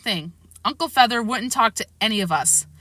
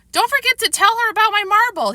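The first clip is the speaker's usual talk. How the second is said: put-on eager child; 13 semitones higher, 5 dB louder than usual. A narrator's reading. Child pestering Mom.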